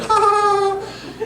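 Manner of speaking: high, drawn-out snickering giggle; imitated sneaky giggle; mock giggling. Prankster hiding while sister knocks, giggling. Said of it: "just giggling".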